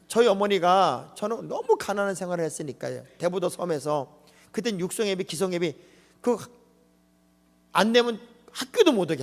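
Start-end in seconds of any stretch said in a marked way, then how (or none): none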